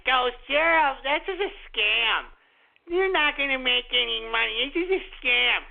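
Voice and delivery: a light sneering voice for the doubters; lightly mock the naysayers; mocking trolls. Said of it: "a lot of you trolls were like".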